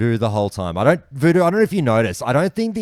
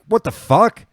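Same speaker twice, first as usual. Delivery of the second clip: high, louder, put-on yelp of outrage; outraged yell; 13 semitones higher, 6 dB louder than usual. Demanding answers on Caxton Street, high outraged yell. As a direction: shout it in disbelief. Repeating outrage at fin thrower.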